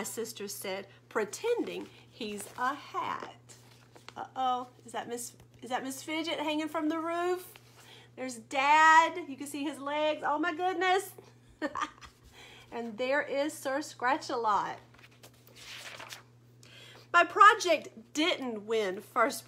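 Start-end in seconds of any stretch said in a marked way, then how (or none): none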